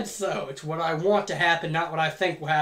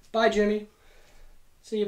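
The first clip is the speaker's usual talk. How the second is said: usual speaking voice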